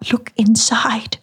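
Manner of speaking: slow, unvoiced whisper. A narrator's reading. Shona urges Moira to open parcel, whispering. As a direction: breathe the instruction fearfully. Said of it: "Shona's voice was a whisper".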